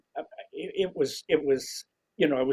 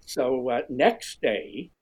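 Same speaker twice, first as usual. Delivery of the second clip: usual speaking voice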